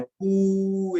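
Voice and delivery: long, high crowing कू; rooster's call. Shown as a demonstration. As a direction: hold the vowel out like a rooster. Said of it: "मुर्गा बोलता है". (मुर्गे जैसी लंबी, ऊँची कू)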